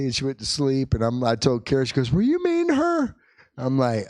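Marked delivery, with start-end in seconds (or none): 2.1-3.2 very high-pitched questioning; imitated shocked wife. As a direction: ask it as a high, hammed-up reproach. Quotes wife's question, heightened take.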